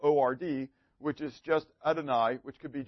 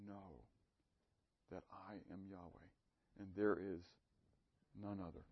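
much softer, lower, intimate sermon voice; soft, lowered devotional preaching; hushed preaching; 8 semitones lower, 12 dB quieter than usual. Acted. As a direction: draw the congregation in, nearly murmuring. Hushed reflective preaching passage.

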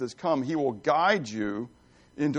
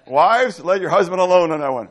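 louder, higher, teasing shout to the wives; playful call-out; 5 semitones higher, 10 dB louder than usual. Real, not acted. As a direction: call across the room to the wives. Calls out to the wives.

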